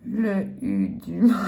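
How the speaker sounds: breaking into giggles mid-word; can't get the word out for laughing. Real, not acted. Scratching letters, cracking up at her own slowness. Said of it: "je rigole".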